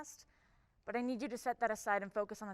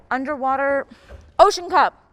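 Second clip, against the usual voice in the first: calling out; 2 semitones higher, 17 dB louder than usual. Acted. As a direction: shout the name across the room. Chief summoning cops by name.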